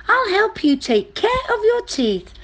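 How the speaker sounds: very high, helpful mouse; little mouse voice. Acted. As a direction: offer help in a tiny mouse voice. Mouse offers help.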